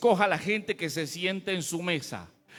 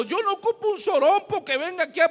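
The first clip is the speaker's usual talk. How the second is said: indignant preaching; 7 semitones higher, 7 dB louder than usual. Real, not acted. Pastor indignantly rejecting show-offs.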